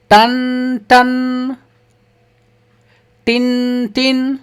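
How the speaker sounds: slow, drawn-out ringing ton ton, tin tin; a child-friendly bell chime, stretched long; bell sound imitation. Shown as a demonstration. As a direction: ring it out like a temple bell. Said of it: "घंटी का ध्वनि कैसे होता है?".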